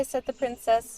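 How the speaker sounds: ordinary delivery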